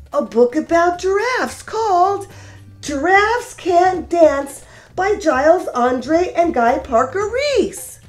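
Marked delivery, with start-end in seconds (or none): none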